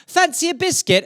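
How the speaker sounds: high, light British offer; high posh British hostess voice; English host. Retold or imitated.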